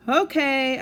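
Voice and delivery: high, loud answering call; yelled back. Acted. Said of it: "I yelled back".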